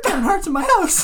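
very high, loud fan panic; hammed-up celebrity freak-out; mock starstruck squeal. Joins the starstruck mom act.

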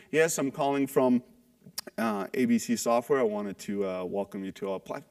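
low, soft, dragging caller voice with no energy; bored, unenthusiastic. Shown as a demonstration. Caller on the phone sounding bored to the prospect, low and flat. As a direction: sound like you'd rather be anywhere else. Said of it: "they sound like this".

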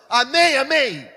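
loud, high, drawn-out amen; rousing congregational shout. Retold or imitated. Preacher leading a cinema crowd, loud rousing shout.

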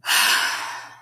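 big wordless exhale; long breathy sigh; heavy sigh. Real, not acted. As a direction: let out a heavy, audible sigh. Sigh after reading grim warnings.

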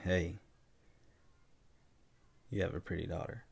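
soft, creepy come-on; creepy compliment. Shown as a demonstration. Showing how tone changes a compliment to a parent, creepily soft. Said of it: "You creep".

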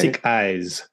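thick ice as "sick eyes", in a French learner's sounds; French speaker's mispronunciation. Retold or imitated. Quoting a French speaker, ambiguous sick eyes. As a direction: repeat the French slip, s for th. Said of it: "also a French speaker was talking about".

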